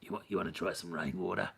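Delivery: menacing Australian bushman, softly; John Jarrett impression. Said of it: "start talking like John Jarrett from Wolf Creek".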